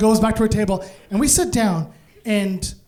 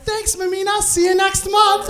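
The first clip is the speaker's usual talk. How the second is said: very high, singsong thanks; cheery grateful hipsters; 11 semitones higher, 3 dB louder than usual. Acted. Hipsters thank the mom for samosas, high and cheery. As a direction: chirp a friendly goodbye in high voice.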